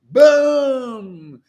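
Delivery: high, loud shouted boom with a laugh behind it; yelled boom. Real, not acted. Preacher shouts boom about ecstatic love. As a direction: let the boom explode. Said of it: "Sorry about yelling".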